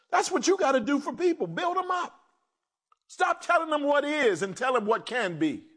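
ordinary talk

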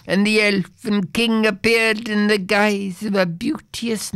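a doomed little creature lamenting his fate; a hint of a wailing goblin, mournful. Goblin tells his sorry tale.

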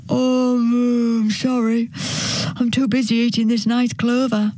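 lightly voiced cow, held on a level tone; mooing cow. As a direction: draw out the moo, then apologise.

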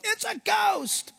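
acted high yelp of alarm; mock terrified shout. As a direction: cry out as if seeing a ghost. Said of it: "That's why they shouted".

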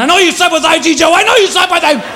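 hammed-up jealous scream; mock jealous screaming. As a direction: scream it at her like a drunk husband. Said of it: "He's screaming".

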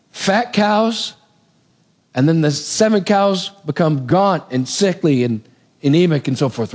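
ordinary talk, nothing marked

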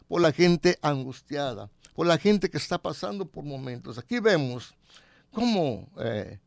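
normal talking voice

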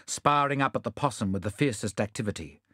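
usual speaking voice

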